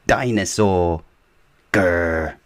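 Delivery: put-on toddler says dinosaur, then a little growl; toddler George. Toddler George says his word.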